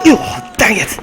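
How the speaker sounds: loud, high exclamation of frustration; frustrated outburst. Real, not acted. Gamer hit by a mishap, loud frustrated outburst.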